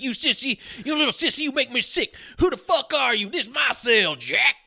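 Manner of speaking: tough Southern thug. Retold or imitated. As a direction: taunt him like a jailhouse bully. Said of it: "but he's like".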